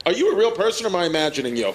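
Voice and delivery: ordinary delivery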